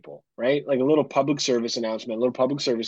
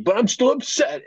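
pouty daughter; 14 semitones higher, 5 dB louder than usual. Retold or imitated. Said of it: "daughter insisting".

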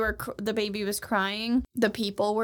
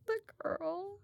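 sheepish murmur; 5 semitones higher, 11 dB quieter, slower than usual. Real, not acted. Reluctant confession.

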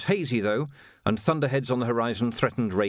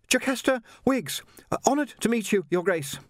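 put-on much higher, polite young Jocasta, a touch breathless; young woman voiced; 11 semitones higher than usual. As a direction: play a well-mannered girl treading water. Jocasta introduces herself.

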